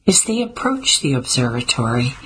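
ordinary delivery, nothing marked

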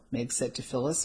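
usual speaking voice

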